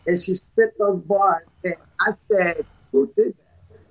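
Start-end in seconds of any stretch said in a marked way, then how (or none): 2.9-3.8 astonished whisper. Retold or imitated. Retold amazement at friend's bars.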